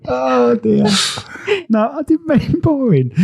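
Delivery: real laughter through the words; laughing at your own slip, apologising; giggly, embarrassed backpedal; laughing apology. Real, not acted.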